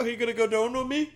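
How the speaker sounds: broad Canadian, on the edge of laughter; mock Canadian accent. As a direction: put on a silly Canadian accent. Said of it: "Canadians are nice".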